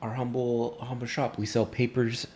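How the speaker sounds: a hint of a fawning shop owner; shopkeeper voice. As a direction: greet them like an eager merchant. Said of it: "the male comes up to you and is like".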